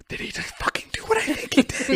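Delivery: hushed, high disbelieving whisper; whispering in shock to Natalie at the club. Retold or imitated.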